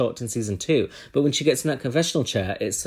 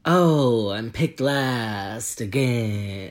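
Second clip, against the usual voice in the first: slow hissing snake voice; drawn-out s like a snake; 2 semitones lower, much slower than usual. Retold or imitated. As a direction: stretch the s sounds, slow and sly. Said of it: "speaking a bit like a snake".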